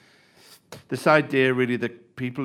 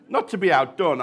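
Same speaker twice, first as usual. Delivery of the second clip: usual speaking voice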